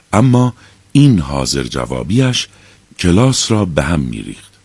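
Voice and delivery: plain reading voice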